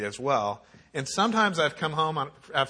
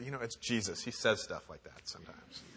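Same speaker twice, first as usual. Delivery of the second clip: disciples' aside; 3 semitones lower, 13 dB quieter than usual. Retold or imitated. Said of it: "turn to people and say".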